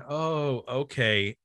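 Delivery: ordinary talk, nothing marked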